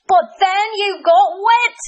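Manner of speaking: high, loud blurt. Acted. Cranky Kareem objecting to Hamdi, high loud blurt. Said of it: "Cranky Kareem blurted".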